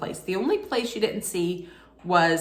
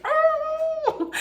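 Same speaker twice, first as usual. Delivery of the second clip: very high laughing cry; joyful yell. Retold or imitated. Fan squealing with laughter over her caught ball. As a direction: let out a giddy squeal. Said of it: "And I'm yelling".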